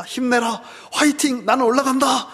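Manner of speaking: Jesus ascending, shouting encouragement; cheerful send-off. Retold or imitated. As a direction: cheer them on as you rise.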